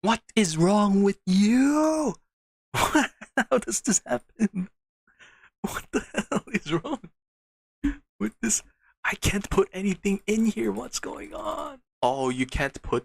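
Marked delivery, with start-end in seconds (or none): 0.0-8.6 very high, loud, slow yell cracking into laughter; frustrated laughing shout. Real, not acted. Screaming at the broken storage, loud and high, laughing. 9.1-11.8 very high complaint fading to a breathless whisper; high exasperated whine. Real, not acted. Venting at the storage system, high whine to whisper.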